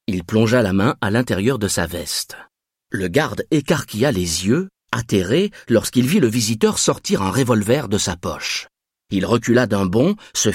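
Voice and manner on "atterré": ordinary delivery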